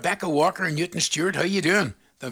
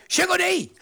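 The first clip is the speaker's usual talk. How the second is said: a bright, high call of the name, pitched well up; mock shout; 3 dB louder than usual. Listener calling out to the host, mock shout. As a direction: sing out the name like calling across a room. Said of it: "Just shout".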